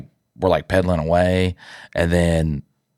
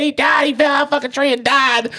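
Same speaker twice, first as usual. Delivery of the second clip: put-on very high, rushed child's wail; hammed-up frantic babbling to parents; mock hysterical panic. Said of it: "I was sitting there doing that shit".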